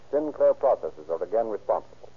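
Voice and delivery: ordinary talk, nothing marked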